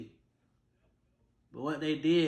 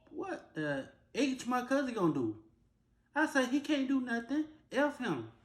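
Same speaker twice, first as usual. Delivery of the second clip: mad retort; 10 semitones higher than usual. Retold or imitated. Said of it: "I was mad".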